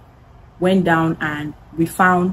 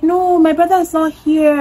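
higher, lightly voiced little girl denying; a hint of a small girl covering for her brother; light mock child voice, higher; mimicking a little girl; 8 semitones higher than usual. Voicing the younger sister.

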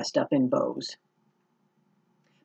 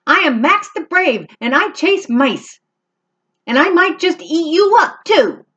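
mock-fierce kitten; 11 semitones higher than usual. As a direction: threaten in a tiny fierce voice. Max threatens Monster.

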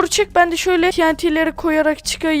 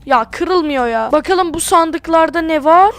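ordinary talk, nothing marked

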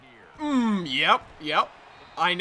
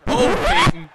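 a high, loud cry of disbelief at the pick; dismayed yell; 10 dB louder, slower than usual. Real, not acted. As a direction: wail the quarterback's name. Quarterback throws a pick.

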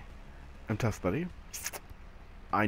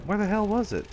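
exasperated; 10 semitones higher than usual. Real, not acted. Angry at unseen enemy.

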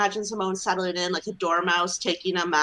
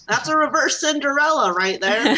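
higher, louder folksy mechanic voice; old-timey mechanic; 6 semitones higher, 5 dB louder than usual. Retold or imitated. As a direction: talk like a gruff old garage mechanic. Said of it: "an old-timey mechanic voice".

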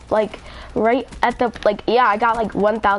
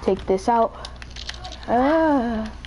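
ordinary talk

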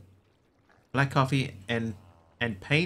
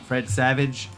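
normal talking voice

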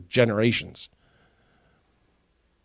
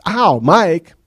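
loud high fake ouch; fake yelp; 7 semitones higher, 12 dB louder than usual. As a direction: overplay the pain. Final fake yell.

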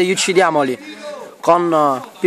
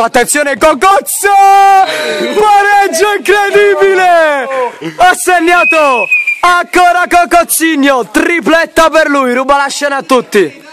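breathless goal scream, much higher and louder; breathless italian goal commentary; 9 semitones higher, 4 dB louder than usual. Real, not acted. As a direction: erupt as the ball goes in. Equaliser scored, commentator erupts.

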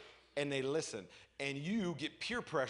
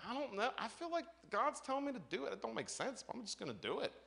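high, unsure self; 9 semitones higher, 3 dB quieter than usual. Retold or imitated. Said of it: "I'm like".

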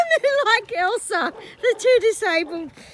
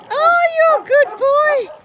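cooing to a horse; 8 semitones higher, 10 dB louder than usual. Real, not acted. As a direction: pet voice, much higher and louder.

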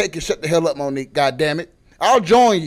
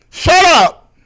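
loud, high, sudden shout; mock-indignant yell; 11 semitones higher, 9 dB louder than usual. Comic defending a fat joke, mock-indignant yell. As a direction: burst out yelling over your own joke.

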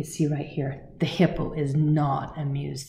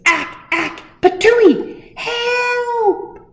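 much higher and louder, crying out for help; panicked hippo; 10 dB louder, much slower than usual. Acted. Hippo begging the bird for help, high and panicked. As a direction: splutter then shriek for help.

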